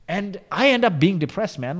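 ordinary delivery

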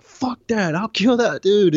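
high, loud retold outburst; angry refusal. Inmate refusing buddies' calming, angry retold outburst.

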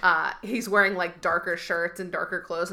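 ordinary delivery

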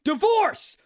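loud, high frustrated yell; mock angry yelling. Man yells at Siri in Starbucks, acted fury. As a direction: yell it at a phone that won't understand. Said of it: "I'm just yelling in the middle of".

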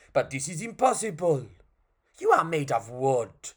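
put-on fairy-clown with a vague French accent. Clown posing as fairy to the puppet, French accent. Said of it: "which is French".

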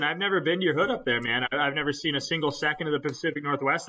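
usual speaking voice

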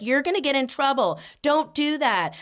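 high, insistent mock scolding; bossy. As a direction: play the bossy sister laying down the law. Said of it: "she was being kinda bossy".

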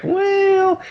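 very high, drawn-out hedging; reluctant squeaky tightwad impression; mock cheapskate. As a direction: stretch it out high, stalling over the money. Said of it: "the cheapskate being like".

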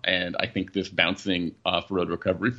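normal talking voice

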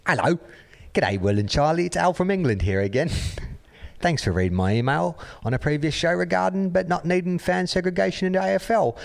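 high, hammed-up English accent; put-on English accent. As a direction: read it as a chirpy mock Englishman. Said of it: "gotta do the proper voice".

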